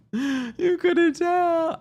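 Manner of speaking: very high, long-drawn words; playful high wail. Pretend. Host clowning to be funny, playful high wail. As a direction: stretch it out in a comic falsetto. Joking outburst after laughing.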